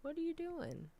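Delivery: high, very soft questioning; fond scolding. Real, not acted. Speaker to a pet choking itself, high soft fussing. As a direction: ask the pet in a small high voice.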